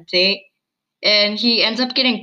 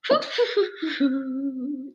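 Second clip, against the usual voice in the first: mock whistle; 3 semitones higher, 5 dB quieter than usual. Copying Hazel's whistle after the bow shot, breathy hoots. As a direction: fake a whistle you can't really do. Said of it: "That was my great whistle".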